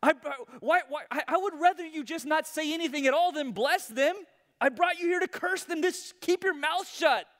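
high, loud, stammering outrage; furious king. Acted. King sputtering at the prophet, high loud outrage. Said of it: "And Balak says".

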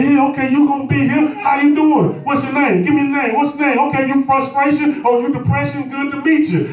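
high-pitched, animated introductions; mock greeting. Believer befriends frustration, greeting it animatedly. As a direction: greet trouble like an unwelcome new houseguest.

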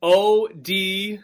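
slow letter by letter spelling. Shown as a demonstration. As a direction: spell each letter slowly. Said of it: "So Jake Odorizzi".

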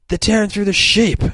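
high, loud put-on woman's voice; Adam Eve alarmed. Adam Eve warning the others, high and loud. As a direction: squeal the warning like a panicked hero. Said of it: "So Adam Eve is just like".

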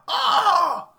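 sudden high, loud scream; retold child scream. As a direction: shriek like a startled little girl. And we hear.

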